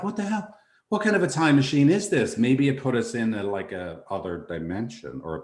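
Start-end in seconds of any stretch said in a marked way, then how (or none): 0.0-2.4 much higher, baffled outburst; mock disbelief. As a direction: react like the gadget betrayed you. Baffled time machine failure.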